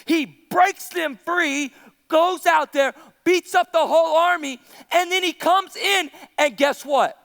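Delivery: ordinary delivery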